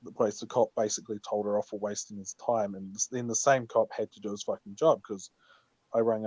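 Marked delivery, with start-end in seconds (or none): none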